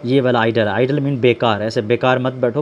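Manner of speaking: normal talking voice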